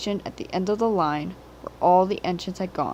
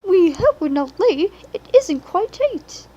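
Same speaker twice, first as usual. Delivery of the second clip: much higher, loud fluttery coach voices; anxious twitter; 10 semitones higher, 7 dB louder than usual. A narrator's reading. Annie and Clarabel worry about being late, high anxious twitter. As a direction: flutter nervously, hoping not to be scolded. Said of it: "twittered anxiously".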